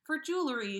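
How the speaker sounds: ordinary delivery, nothing marked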